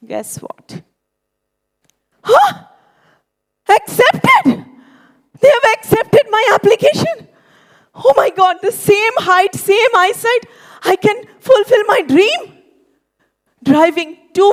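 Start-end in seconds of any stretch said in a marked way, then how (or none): 0.1-0.8 whispered tease, leaning in. Acted. Teasing build-up, whispered aside. 2.2-2.6 high startled huh; surprised gasp. Retold or imitated. Shock at the reply. 3.7-7.3 high, loud overjoyed cry; excited. Retold or imitated. Acceptance letter arrives. 8.0-12.6 excited. Retold or imitated. Reaction to acceptance.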